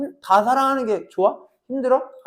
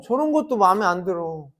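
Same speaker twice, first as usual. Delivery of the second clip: ordinary talk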